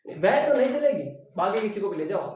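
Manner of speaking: "तो" पर ज़ोर, ऊँचा और तेज़; अड़कर "तो" दबाओ; stress on तो. Shown as a demonstration. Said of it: "तो ने जोर दे दिया". ("तो" पर पूरा बल)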